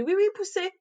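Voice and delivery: high, loud dismissive midwife mimic; mock the indifferent midwife brushing her off; bored midwife. Mother imitates the midwife telling her to push, dismissive.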